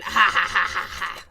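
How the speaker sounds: short burst of real laughter; laughing. Real, not acted. Crafter laughing at her warped shim, real laughter. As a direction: laugh it out, cracking up.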